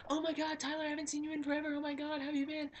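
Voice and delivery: put-on squealing high, loud, fast girly gush; excited girl. Old friend squealing hello at Tyler in the store. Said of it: "this girl I know came up to me".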